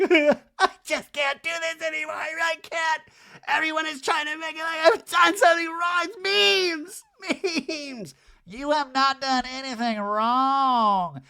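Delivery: very high, loud, sobbing mock Kyle; mock crying Kyle. Recapping Kyle breaking down to Erica in a high mock wail.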